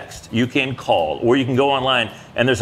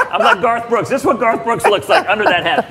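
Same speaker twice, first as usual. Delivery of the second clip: talking through loud laughter; laughing at yourself out loud; 9 semitones higher, 4 dB louder than usual. Real, not acted. Laughing at own bald head.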